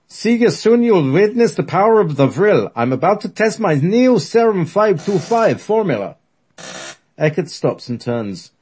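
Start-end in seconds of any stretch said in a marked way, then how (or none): 0.1-6.2 put-on thick German accent, loud and high. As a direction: boom it out in heavy German. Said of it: "In his thick German accent".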